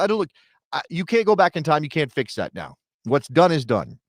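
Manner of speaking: ordinary talk, nothing marked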